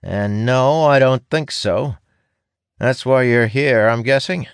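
high, knowing apprentice voice; sarcastic apprentice. A narrator's reading. Young apprentice guessing why the ranger came, sarcastic. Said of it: "he replies sarcastically".

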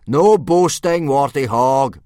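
teacher booming at a pupil. A narrator's reading. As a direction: crush the question with a boom. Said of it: "boomed Gobber".

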